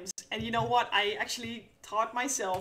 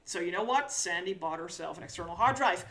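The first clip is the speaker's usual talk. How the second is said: ordinary talk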